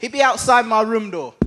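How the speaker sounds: ordinary delivery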